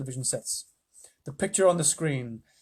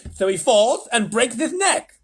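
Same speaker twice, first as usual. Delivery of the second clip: high, slow, louder gloat; triumphant president; 8 semitones higher, 7 dB louder, slower than usual. Acted. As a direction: savour the punchline.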